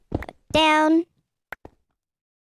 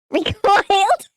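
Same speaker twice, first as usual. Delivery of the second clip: laughing; slower than usual. Real, not acted. Laughing in changer voice.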